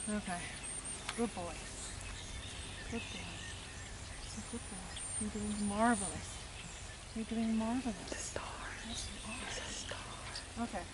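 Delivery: tender praise. Real, not acted. Healer to the dog after treatment, whispered tender praise. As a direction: hush it out like praising a sleepy dog.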